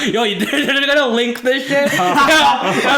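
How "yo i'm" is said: loud, high panicked yelp; sudden alarm cracking up the pitch; alarmed outburst. Real, not acted. Podcast host panics the clip will get linked, loud alarm.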